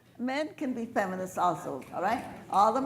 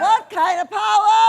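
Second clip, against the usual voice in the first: much higher and much louder call to the crowd; shouted rally call; 9 semitones higher, 15 dB louder than usual. Real, not acted. Asking the crowd what kind of power, loud shout. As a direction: hurl the question out to the whole room. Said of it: "I want you to shout it so loud".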